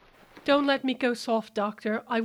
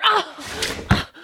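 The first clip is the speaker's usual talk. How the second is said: high sharp cries of pain; pained cry; 12 semitones higher, 8 dB louder than usual. Acted. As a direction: yelp as the grip tightens. Hand being crushed.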